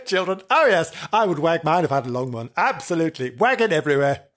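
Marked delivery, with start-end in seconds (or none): none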